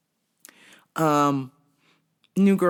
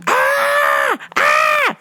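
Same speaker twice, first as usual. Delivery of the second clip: shrill, much higher and louder screams; screaming; 5 dB louder than usual. Retold or imitated. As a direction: scream like Sam Kinison. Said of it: "She was like".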